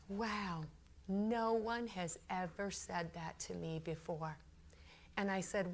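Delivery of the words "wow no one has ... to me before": very soft, hushed reply, imitated; stunned humility. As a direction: take it in quietly, touched. Harvey's stunned reply, retold.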